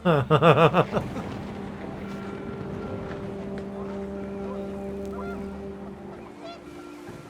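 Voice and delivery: long rising giggle; high delighted laugh; real laughter. Real, not acted. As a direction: burst out laughing at the joke. Laughs at the middle finger.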